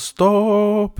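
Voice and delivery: high, loud, drawn-out shout of STOPP; ropt utrop. A narrator's reading. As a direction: call out loudly to halt a car. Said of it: "Og da ropte hun". (høyt, langtrukkent rop)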